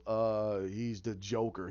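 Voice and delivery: low, quiet reply; dropped-pitch retold answer; lightly voiced henchman. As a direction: imitate a dopey underling lightly.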